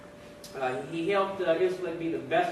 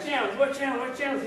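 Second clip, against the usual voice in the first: urgent searching; 7 semitones higher than usual. Acted. Searching for bunkmate in trouble.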